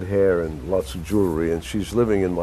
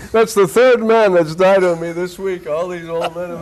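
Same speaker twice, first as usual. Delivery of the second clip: put-on higher, louder voice of an old lady complaining; Fern Rosenthal; 10 semitones higher, 5 dB louder than usual. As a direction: sigh it out as a put-upon widow with suitors. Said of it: "she says".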